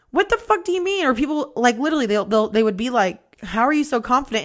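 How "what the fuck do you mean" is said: high-pitched indignant retort; retold annoyed comeback, pitch jumping up; pissed off. Speaker snapping back at being called confident, retold annoyance. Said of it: "like, pissed off, and I'd be like".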